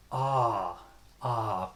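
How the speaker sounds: long, falling "Ah"; drawn-out open "Ah" demo; open AH vowel. Shown as a demonstration. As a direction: say "Ah" with the mouth wide open. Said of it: "Just open your mouth wide".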